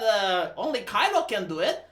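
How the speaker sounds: ordinary talk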